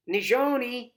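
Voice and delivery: high, loud call across the yard; grandma calling a child in; calling from inside. A narrator's reading. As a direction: call her name from inside the house. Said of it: "a voice calls from inside the house".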